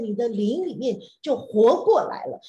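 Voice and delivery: ordinary talk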